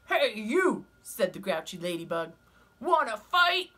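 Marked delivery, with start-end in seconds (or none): 0.1-0.9 high, loud, grumpy bug; grouchy ladybug voice. Acted. Ladybug challenges stag beetle. 2.8-3.7 high, loud, grumpy bug; grouchy ladybug voice. Acted. Ladybug challenges stag beetle.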